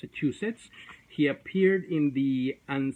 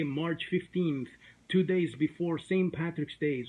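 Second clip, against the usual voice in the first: ordinary talk, nothing marked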